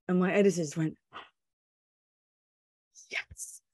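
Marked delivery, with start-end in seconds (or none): 1.1-3.6 breathless whispered yes; gasp, then whisper the yes in triumph. Retold or imitated. The editor realizing the scoop, whispered triumph.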